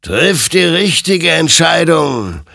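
slow, loud, high warning; stern admonition. A narrator's reading. Creature warns Hector as he aims the gun, stern admonishing. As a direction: admonish with long, ringing words. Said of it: "ermahnte ihn sein Gegenüber".

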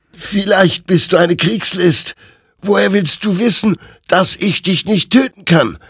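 high, loud, agitated challenge; high-pitched, distrustful soldier; desperate soldier Hector, doubting. Acted. Voices Hector challenging the rider.

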